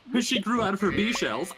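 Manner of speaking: giggling through the punchline; laughing. Real, not acted. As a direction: laugh while saying it. Laughing through own joke punchline.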